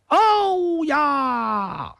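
high, lilting put-on accent; high sing-song southern accent; disguised southern accent. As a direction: sing the greeting high in a fake southern lilt. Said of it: "我这个口音呐，还要变变".

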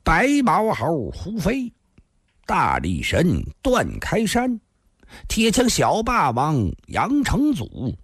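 each name laid out slow and deliberate; slowly. Acted. 瘦长汉子缓缓报出六个名字，一字一顿. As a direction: count off the names one by one, unhurried. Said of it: "缓缓地说道".

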